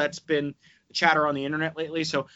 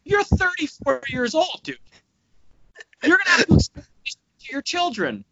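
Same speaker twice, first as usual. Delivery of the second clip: higher, louder exasperated rant; angry rant; 8 semitones higher, 3 dB louder than usual. Real, not acted. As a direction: vent hard at a dumb idea. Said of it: "You're so mad about this".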